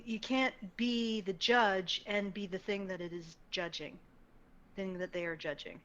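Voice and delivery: ordinary talk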